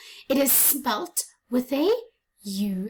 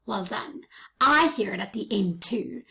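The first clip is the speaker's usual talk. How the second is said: ordinary delivery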